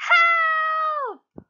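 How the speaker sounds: high, loud frightened cry for help; scream. A narrator's reading. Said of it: "and screamed".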